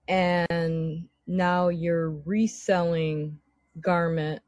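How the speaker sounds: ordinary talk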